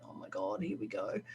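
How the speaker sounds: a private inner sigh, barely voiced; exasperated aside. Her inner reaction to the client.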